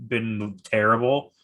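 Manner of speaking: ordinary talk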